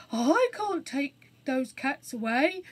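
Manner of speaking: beg, lips trembling; rough voice, pleading. Acted. Trembling burglar.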